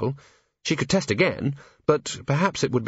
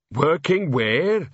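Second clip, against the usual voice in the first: slow troll voice; 5 semitones higher, much slower than usual. Acted. Troll asks.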